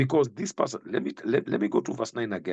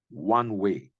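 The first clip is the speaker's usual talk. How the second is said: ordinary talk, nothing marked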